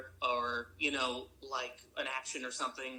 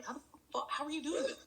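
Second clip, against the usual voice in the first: retold astonishment, much higher; marveling at actors improvising in accent; amazed disbelief; 11 semitones higher than usual. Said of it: "seeing banter and going".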